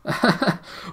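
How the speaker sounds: high, loud joyful laugh; astonished laugh. A narrator's reading. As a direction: burst out laughing in delight. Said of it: "He gave a great laugh of astonished joy".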